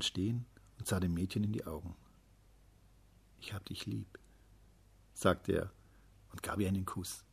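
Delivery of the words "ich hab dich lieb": hushed, very soft declaration of love; tender murmur. A narrator's reading. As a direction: say it softly, almost in a whisper. Young man confesses love to girl.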